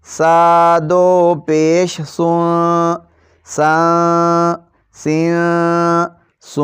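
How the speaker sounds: slow, clear, even chanting of syllables; qaida recitation drill. Shown as a demonstration. Teacher drilling children in a steady recitation chant. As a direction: recite each syllable cleanly for the pupils.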